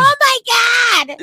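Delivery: high, loud mock scream; retold scream. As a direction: shriek it like the horrified bystander. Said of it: "she screamed".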